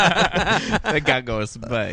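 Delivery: slow, mock little-kid voice; childish voice. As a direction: parrot it like a small child. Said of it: "Are you, like, eight-year-old?".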